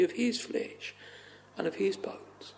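sinking into a near whisper; reverent hush. Real, not acted. Preacher telling believers they are Christ's body, hushed. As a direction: let each phrase fall away very softly.

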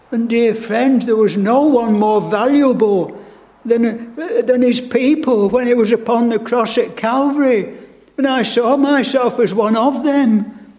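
rising, urgent sermon voice; fervent preaching. Acted. Preacher pleading with his flock, fervent and rising. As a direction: press the congregation hard.

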